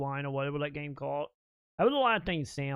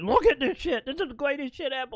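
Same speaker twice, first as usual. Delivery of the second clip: pitched-up mock excitement, fast finish; giddy bragging; 9 semitones higher than usual. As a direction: show off the tape to everyone, thrilled. Retelling Timmy bragging to neighborhood.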